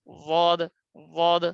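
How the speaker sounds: Dwad said with a pressed, heavy d; teacher's Arabic letter demo. Shown as a demonstration. Teacher demonstrates the letter dwad to students, pressed and heavy. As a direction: model the letter dwad, pressing the tongue side. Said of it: "যেভাবে উচ্চারণ করেন".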